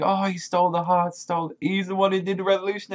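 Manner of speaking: high-pitched, breathless praise; imitating a gushing fan. Fan Bartolomeo raves about Usopp, high-pitched and mock-excited. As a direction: gush up high like a fanboy. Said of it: "He's like".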